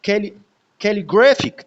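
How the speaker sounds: Calligraphic with lighter stress on कै, strong punch on ग्रा; secondary stress demo. Shown as a demonstration. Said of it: "ये secondary stress है". (Calligraphic में कै पर हल्का, ग्रा पर ज़ोरदार स्ट्रेस)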